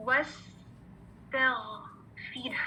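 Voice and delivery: slow, whispered model of वस्तगफिर; whispered slow recitation for students to copy. Shown as a demonstration. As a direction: breathe the word out slowly for the class. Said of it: "दोबारा सुने".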